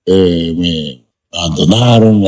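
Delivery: usual speaking voice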